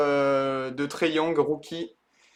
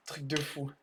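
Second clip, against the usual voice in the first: whisper; 4 semitones lower, 12 dB quieter than usual. Real, not acted. Stunned after the big pull.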